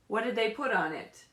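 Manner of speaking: normal talking voice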